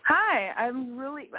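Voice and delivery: usual speaking voice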